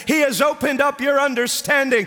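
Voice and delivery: high, ringing preacher's cry; preaching at full force. Acted. Preacher shouting Peter's declaration to the congregation, fervent. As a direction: proclaim it with full fervour.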